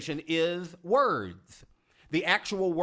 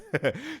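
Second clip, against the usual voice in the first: short amused laugh; 8 semitones higher than usual. Real, not acted. Laughs after the audience exercise.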